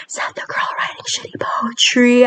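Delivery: hushed, throwaway dig at yourself; whispered aside. Acted. Self-mocking aside in performed poem.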